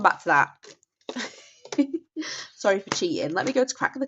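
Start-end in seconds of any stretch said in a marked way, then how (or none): 1.6-2.5 a quick high guilty giggle. Real, not acted. Vlogger caught cheating at her own savings game, guilty giggle.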